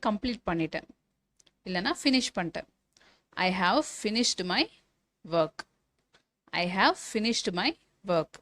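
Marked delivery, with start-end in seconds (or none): 3.3-8.3 slow dictation. Shown as a demonstration. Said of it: "teacher dictates a model sentence".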